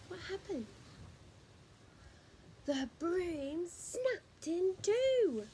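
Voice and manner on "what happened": gasp, then a worried question; startled storyteller aside; alarmed aside to listener; gasp of surprise. Acted. Reader reacts as broom breaks.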